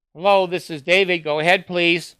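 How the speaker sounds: loud, bright phone hello; phone greeting. Real, not acted. Radio host answering the phone call, loud and bright. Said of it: "I have to take this".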